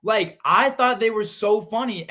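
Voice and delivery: high, loud, insistent; protesting the host's jab about season one; emphatic protest. Real, not acted. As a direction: push back fast and high. Defending himself against teasing host.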